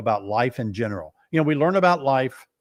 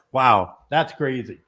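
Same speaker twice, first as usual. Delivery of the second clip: usual speaking voice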